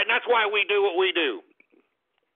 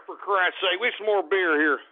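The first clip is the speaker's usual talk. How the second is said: ordinary talk, nothing marked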